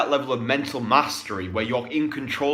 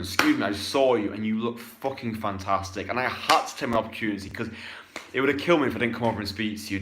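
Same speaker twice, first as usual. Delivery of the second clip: softer and fast, rushing to the end; fast confident pickup line; 3 semitones lower, 4 dB quieter, faster than usual. Shown as a demonstration. Coach demonstrating a pickup line, fast and soft. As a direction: rattle off the line quickly. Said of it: "Do you see how I'm doing this".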